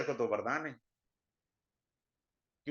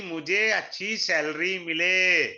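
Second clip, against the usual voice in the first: loud, drawn-out mimicry of a job-hopper; slow, emphatic mock longing; mock eager wish; 4 dB louder, slower than usual. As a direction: stretch it out loudly, mocking his wish. Mimics job-hopper wanting a salary.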